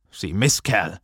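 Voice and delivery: mildly angry hiss. A narrator's reading. Teacher back on safe ground insulting Booker, hissing. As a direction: hiss the insult with restrained anger. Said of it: "zischte der Mann".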